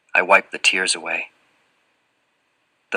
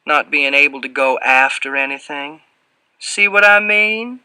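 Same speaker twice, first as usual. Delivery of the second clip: put-on Southern accent; 7 semitones higher, 4 dB louder than usual. A narrator's reading. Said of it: "drips with a heavy Southern accent".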